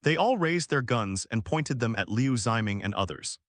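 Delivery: usual speaking voice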